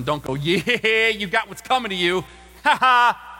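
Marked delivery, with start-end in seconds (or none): 0.3-2.6 a smug, jeering taunt with a laugh, louder; mock gloating. Mocking gloat at someone's downfall. 2.6-3.4 a loud jeering ha ha; mocking laugh. Gloating laugh in taunt.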